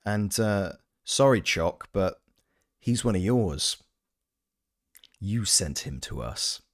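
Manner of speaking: normal talking voice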